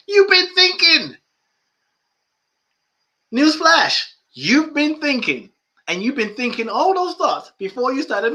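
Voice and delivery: high, loud mock-scolding; teasing newsflash. Real, not acted. Teacher teasing students about thinking, loud and high. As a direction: tease them, loudly and up high. Mocking the nervous meditator.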